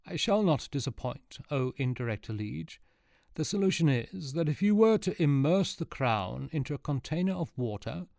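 lightly voiced old sage, earnest; a hint of a doddery wise man explaining; old sage Archimedes. Archimedes explains his solution to the court, lightly voiced old sage.

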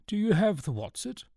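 high-pitched questioning don; old professor. A narrator's reading. Professor asking Newton for the watch, high-voiced. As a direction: ask it in a high character voice.

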